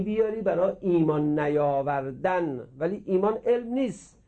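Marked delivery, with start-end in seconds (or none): none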